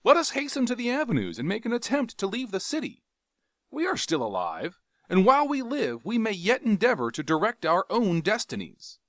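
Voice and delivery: high, brisk rallying speech; urgent encouragement. Acted. Carthoris rallying Jav to flee. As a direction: push him to act now.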